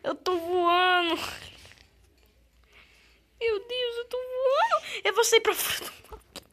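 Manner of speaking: high, loud, stunned yelling; excited shriek. Real, not acted. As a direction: shriek in disbelief. Glitch sends character flying.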